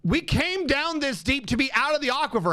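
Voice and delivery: high, strained disbelieving rant; venting at the game in outrage; exasperated rant. Real, not acted. Aquifer again, genuinely pissed.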